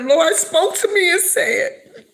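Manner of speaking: pushing words through tears; crying. Real, not acted. Telling how the Lord spoke after the funeral, tearful.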